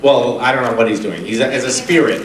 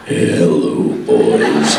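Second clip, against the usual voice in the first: very low, whispery hello; deep Crowley imitation; much slower than usual. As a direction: sink below everyone else. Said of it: "he's like".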